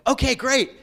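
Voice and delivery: high excited okay great; eager kid. Retold or imitated. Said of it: "And I said".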